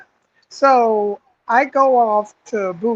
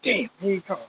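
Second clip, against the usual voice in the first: soft, resigned aside; hushed dread; 10 dB quieter, faster than usual. Retold or imitated. Recruit seeing the sergeant coming over, hushed dread. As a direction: say it under your breath as trouble nears.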